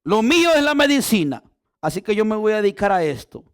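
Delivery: loud, high, fervent sermon delivery; full-force preaching. Acted. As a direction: preach it to the whole church, full voice.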